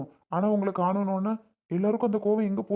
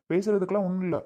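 usual speaking voice

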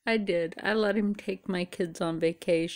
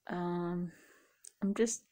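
ordinary talk, nothing marked

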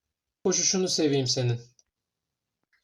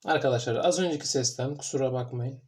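usual speaking voice

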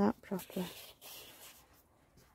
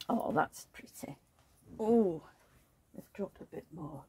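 whisper; 3 semitones lower, 5 dB quieter than usual. Acted. Admiring baby softly.

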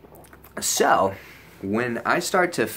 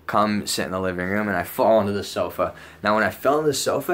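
ordinary talk, nothing marked

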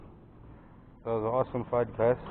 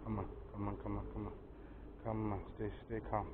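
quiet coaxing; 4 semitones lower, 15 dB quieter than usual. Real, not acted. Coaxing hooked fish.

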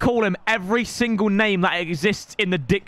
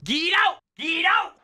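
high shouted dismissal; 10 semitones higher than usual. Real, not acted. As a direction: shout the opponent away. Telling opponent to leave.

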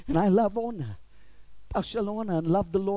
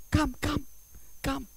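high, hushed coaxing; soft beckoning; 7 semitones higher, 6 dB quieter than usual. Acted. Sheltering one whom enemies are after, soft beckoning. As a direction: coax someone along quietly.

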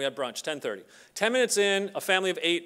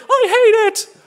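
much higher and louder, laughing; mock outburst; 15 dB louder, slower than usual. Imitating everyone's reaction to the child's drawing, laughing mock outburst. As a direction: squeal it like a furious kid, half laughing.

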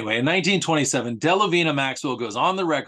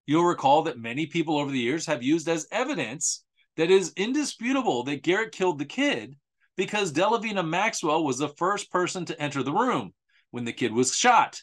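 usual speaking voice

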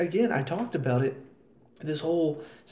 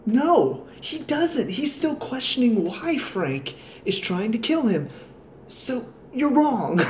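indignant retort; 6 semitones higher, slower than usual. Real, not acted. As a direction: snap back: no, he doesn't. Arguing with the character.

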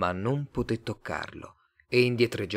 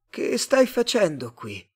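puzzled question; 10 semitones higher, 4 dB louder than usual. A narrator's reading. Tomás questions the stranger, not understood.

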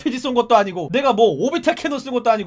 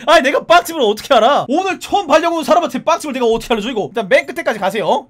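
usual speaking voice

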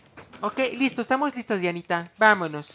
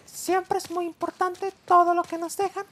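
mock sage voice; 13 semitones higher, 4 dB louder than usual. Mock-wise gaming advice.

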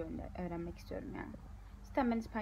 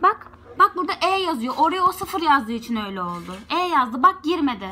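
worked-up; 4 semitones higher, 15 dB louder than usual. Real, not acted. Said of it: "Bak gene sinirlendim".

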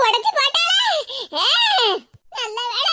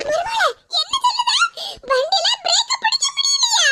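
panicked cry; 8 semitones higher than usual. Acted. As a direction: cry out in comic panic, voice much higher. Mother-in-law panics on runaway scooter.

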